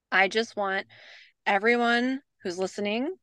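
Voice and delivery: normal talking voice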